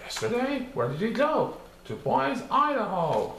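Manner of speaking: plain reading voice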